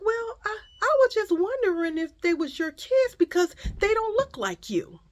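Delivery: high, hesitant explaining voice; imitated nosy woman. Nosy shopper excusing her question, imitated hesitantly. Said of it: "She was like".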